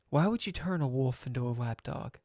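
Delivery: usual speaking voice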